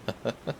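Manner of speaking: short, light chuckle. Acted. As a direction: give a brief amused chuckle. Amused by companion on arrival.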